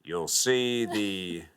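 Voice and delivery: slow, calm captain over the intercom; lightly voiced pilot, unhurried pauses; airline pilot announcement. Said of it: "I did my pilot voice".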